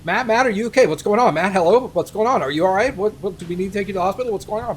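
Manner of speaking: fast rambling gabble. Retold or imitated. Friends gabbling at him during the drug haze, fast and loud. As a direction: rattle the questions off nonstop. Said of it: "they sounded like this".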